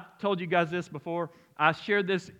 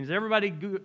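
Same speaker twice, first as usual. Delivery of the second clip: ordinary delivery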